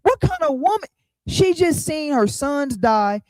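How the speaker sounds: high, loud and slowed with amazement; can't believe what she did; preacher stunned that the mother laughs; outraged disbelief. Real, not acted.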